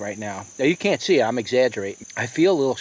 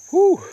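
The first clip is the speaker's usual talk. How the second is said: sharp exhale; 7 dB louder than usual. Real, not acted. Burning pepper just hit him.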